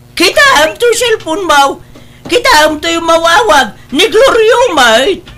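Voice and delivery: urgent shout about the phone; urgent call out. Acted. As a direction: hurry her to look at the phone. Urgently pointing out ringing phone.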